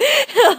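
a sudden high laugh; giggling at her own outrage; high burst of laughter. Real, not acted. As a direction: laugh at yourself, loud and high.

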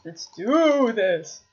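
loud, long drawn-out DO; hyped-up battle cry. Real, not acted. Gamer psyching himself up as the round starts, rallying. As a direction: stretch it slowly into a rallying call.

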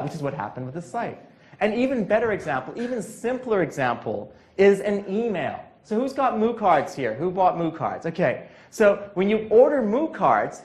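usual speaking voice

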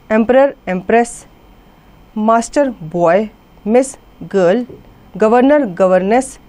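normal talking voice

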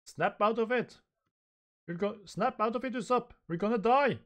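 high, frantic acted cries; panicked pleading. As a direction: beg a crewmate in a high voice. Panicked crew facing a giant lion.

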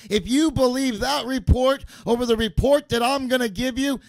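full-force preaching. Acted. Preacher urging his congregation to believe, full-force preaching. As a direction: preach it, rising to a pitch.

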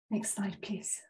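unvoiced whisper to the operator; quick whispered request off-mic; whispered aside. Real, not acted.

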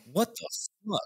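high incredulous squeal; shocked disbelief. Real, not acted. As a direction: yelp it out in utter disbelief. Shocked at scone and mayonnaise.

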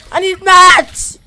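loud shout. Real, not acted. Shouting for materials.

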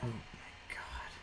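slow breathless whisper; hushed disbelief; stunned whisper. Real, not acted. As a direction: whisper it in shock. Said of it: "I'm stunned".

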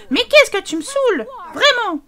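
high, loud exasperation; fed up with him; wife at the end of her patience; exasperated wife. Acted. Voicing wife in quarrel.

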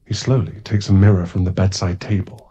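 normal talking voice

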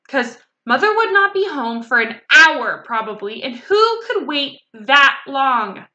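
put-on loud, exasperated kid complaint; impatient child. A narrator's reading. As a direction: stretch out the last words in exasperation. Impatient child complaining about waiting.

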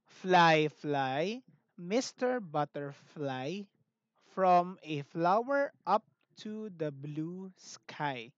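slow, careful rhyme for the class to echo; slow model recitation of the butterfly rhyme; rhyme recital. Shown as a demonstration. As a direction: read each word slowly so children can copy. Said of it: "repeat the rhymes that I'm going to read out".

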